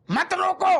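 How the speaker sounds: shouted plea. Acted. Patient crying out to the doctor, shouting. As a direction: belt it out like a desperate plea.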